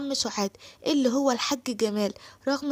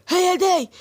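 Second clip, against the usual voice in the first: 1 furious shout; 7 semitones higher, 6 dB louder, much slower than usual. Acted. Asim spots the woman who cheated.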